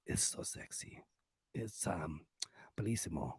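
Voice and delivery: hushed, low Spanish-lover praise; sultry murmur. Acted. Host praising a podcast as Latin lover, sultry. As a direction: savour it softly, in a seductive undertone.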